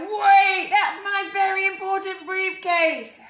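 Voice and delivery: loud high bellow. Acted. Mister Brown bellowing at the departing bus.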